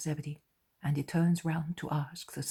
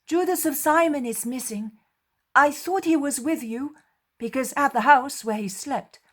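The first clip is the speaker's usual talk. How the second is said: much higher and louder; voiced greeting; 10 semitones higher, 11 dB louder than usual. Jesus greeting the apostles, higher and louder. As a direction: call out the greeting.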